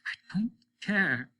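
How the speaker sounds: tearful whisper, slow and breathy; crying whisper. Acted. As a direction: choke it out through tears. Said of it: "You're crying like a baby".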